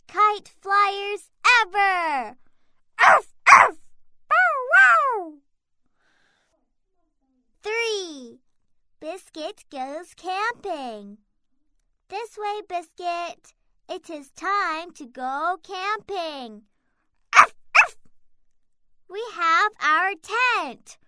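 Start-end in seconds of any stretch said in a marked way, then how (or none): 3.0-5.3 high yappy puppy barks; puppy barking. Acted. Narrator voices barking puppy. 17.4-18.0 puppy barking. Acted. Puppy barks in story.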